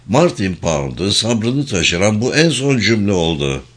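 plain reading voice

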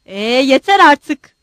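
loud, slow, drawn-out outburst; exasperated shout. A narrator's reading. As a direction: shout that you have had enough. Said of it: "diye bağırdı".

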